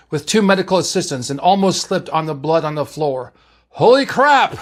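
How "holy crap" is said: high, loud exclamation; startled outburst at full voice; shocked yell. A narrator's reading. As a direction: yell it out in alarm, pitch leaping up. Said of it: "McCoy yelled".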